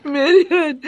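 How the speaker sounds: ordinary talk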